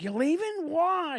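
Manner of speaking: high whining "You're leaving? Why?"; mock whine. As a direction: imitate the clingy friend, high. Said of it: "he's like".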